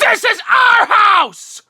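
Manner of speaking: slow, high, loud war cry; defiant shout. Acted. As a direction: bellow it to scare them off. Defending house from intruders.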